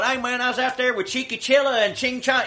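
hammed-up fake Spanish accent, high; bad mock accent. Podcast host hams a bad mock accent. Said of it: "my bad racist Chinese impression".

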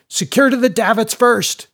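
high, loud bark over the wind; shouted order. A narrator's reading. Mate to sailors over the gale, shouting an order. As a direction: bellow it across the storm. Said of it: "shouted the mate over the gale".

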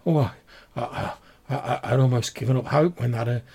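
hopeful but tearful wife, words tripping; distraught village woman. Acted. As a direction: falter through fragile hope.

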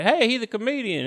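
acted heads-up to colleagues; cop calling out. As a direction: call over to the other cops. Cop calls out to other cops.

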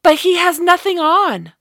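high, loud blurted cry; cried out. A narrator's reading. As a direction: blurt it out without thinking. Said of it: "her voice cried out".

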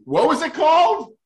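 high, loud, squeaky cry of frustration at forgetting; exasperated squeak. Real, not acted. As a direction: squeal it out, fed up with your own memory. Forgetting the café's name mid-story.

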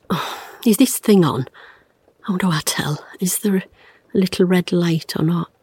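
unvoiced whisper to herself; hushed muttering while checking the red light; whispered aside. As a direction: whisper while fiddling with the tape recorder. Fumbling with recorder, whispering aside.

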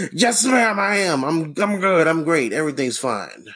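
very high, eager saluting voice easing down; mock Navy officer. Worker saluting his lady managers, mock eager Navy officer. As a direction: snap to attention with exaggerated eagerness. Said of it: "salute them like some fucking Navy officer".

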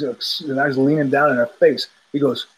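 normal talking voice

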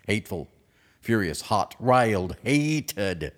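ordinary talk

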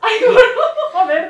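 loud, high laughing cry; laughing exclamation. Real, not acted. Listener cracking up at the story, loud. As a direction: burst out laughing.

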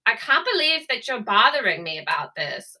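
indignant mock Anna Delvey; Anna Delvey accent. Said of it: "But that voi".

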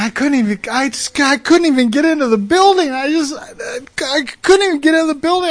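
high, breaking, tearful rush; crying. Retold or imitated. Weeping mafia man telling his counselor what happened, crying. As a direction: sob it out, overwhelmed. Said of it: "he's crying".

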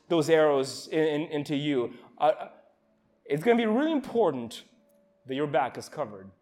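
ordinary talk, nothing marked

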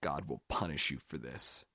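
low, soft, understated sob; quiet, tearful curse; sobbing. A narrator's reading. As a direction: say it weakly through tears. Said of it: "he said, sobbing".

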